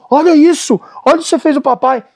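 high mock scolding of the dog; a hint of a wounded dog owner. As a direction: scold the dog like a hurt papa. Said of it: "Ele tira a mão".

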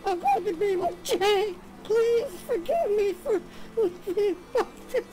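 high sobbing read-aloud, words drawn out; crying while reading. Acted. Daughter reads her mother's farewell note, crying. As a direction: read it through rising sobs.

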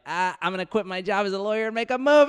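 excited. Retold or imitated. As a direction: announce it with glee. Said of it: "you're like".

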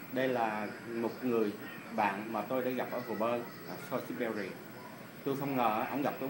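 ordinary delivery

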